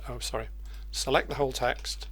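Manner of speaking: ordinary delivery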